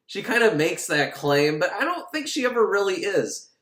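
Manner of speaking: ordinary talk, nothing marked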